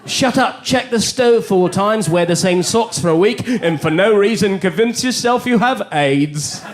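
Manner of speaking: bossy life coach in a put-on English accent. Mind ordering the brain around, put-on English accent. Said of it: "How come you have an English accent".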